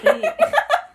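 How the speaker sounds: high, loud cackling witch laugh. Real, not acted. Laughing during the accent game, a loud witch cackle. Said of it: "That you, the witch laugh".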